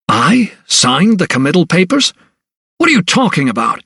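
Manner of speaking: anger and dismay. A narrator's reading. Doctor learning he signed the papers, angry dismay. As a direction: disbelief turning to anger. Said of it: "His voice registered anger tinged with utter dismay".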